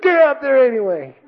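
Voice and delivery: very high mock sergeant's yell; drill sergeant. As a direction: imitate a sergeant overruling an excuse. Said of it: "the drill sergeant looks at me".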